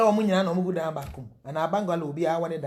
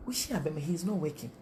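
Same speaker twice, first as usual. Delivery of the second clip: normal talking voice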